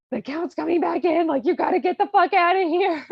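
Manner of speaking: high, loud mock panic; hammed-up frantic warning, voice pushed up; urgent warning. Susanna warns Cherubino the Count is coming, urgent and high.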